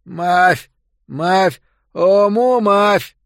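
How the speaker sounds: loud, slow mushy chant; mouthless calling. A narrator's reading. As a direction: hawk the ointment with no lips. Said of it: "повторяя".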